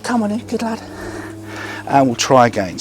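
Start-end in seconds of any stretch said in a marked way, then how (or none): 0.0-0.8 high, soft coaxing call to the dog; dog praise. Real, not acted. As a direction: softly lift the voice to lure him in.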